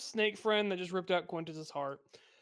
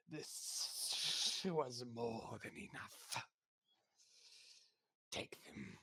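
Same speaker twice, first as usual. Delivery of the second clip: very slow, whispered hiss; snake man; 4 semitones lower, 9 dB quieter, much slower than usual. Acted. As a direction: whisper it like a snake, drawing out each word. Snake man speaks in the game.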